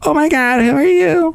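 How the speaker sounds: high, startled squeal; startled vocal fry girl. Acted. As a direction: shriek in surprise.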